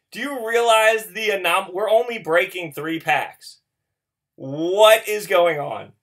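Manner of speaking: loud, breathless astonishment; loud amazed rant about the card; excited disbelief. Real, not acted. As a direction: still reeling, half shouting. Still shocked at rare pull.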